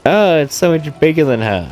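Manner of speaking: ordinary delivery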